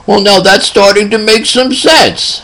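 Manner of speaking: put-on high-pitched old country man voice; little old man. Old patient replying to his alarmed nurse, little old man voice. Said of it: "he talks like this".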